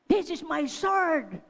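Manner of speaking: slow, loud hammed-up villain; playful Goliath threat. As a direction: draw it out like a giant in child's play. Aunt plays Goliath threatening child.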